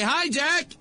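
high, rising call to a friend; acted friendly hail, pitched right up; mock airport greeting.

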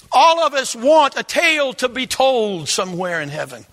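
fervent, loud preaching. Acted. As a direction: preach it with full force. Preaching at full force.

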